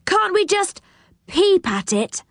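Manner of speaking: put-on high, keen child's plea; excited little request; eager pleading. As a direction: ask eagerly, starting high. Rick begs to go.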